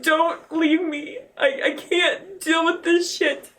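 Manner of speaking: slow, drawn-out plea; retold pleading. Mother begging her son not to leave, slow plea. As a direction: stretch each word as a desperate plea.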